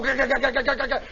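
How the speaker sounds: rapid stuttering "gah" clatter; rattling machine noise. Retold or imitated. Imitating the winch as bolts fly, staccato rattle. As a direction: rattle like a winch tearing loose. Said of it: "And it's going".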